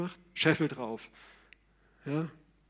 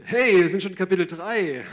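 surprised; 4 semitones higher, 10 dB louder than usual. Real, not acted. Surprised at progress through slides.

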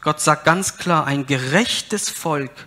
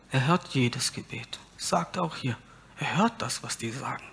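soft reassurance; 2 semitones lower, 9 dB quieter than usual. Real, not acted.

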